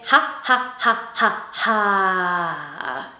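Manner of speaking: short staccato ha's, then one long falling ha; breath exercise ha. Shown as a demonstration. Singing teacher demonstrates a breath exercise, staccato ha's. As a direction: pulse ha four times, hold the last. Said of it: "we're gonna say".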